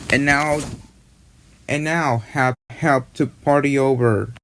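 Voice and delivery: ordinary talk, nothing marked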